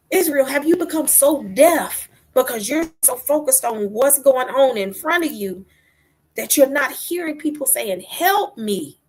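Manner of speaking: impassioned rebuke. Retold or imitated. Preacher confronts Israel for ignoring cries, impassioned rebuke.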